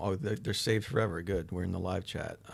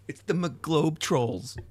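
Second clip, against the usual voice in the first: grand announcement; 8 semitones higher, 5 dB louder, much slower than usual. Pretend. Announcing his army name.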